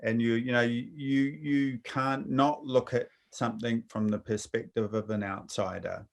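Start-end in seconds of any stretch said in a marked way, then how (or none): none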